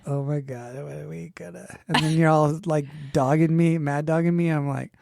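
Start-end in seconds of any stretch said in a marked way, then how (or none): 0.1-1.7 worried mutter. Retold or imitated.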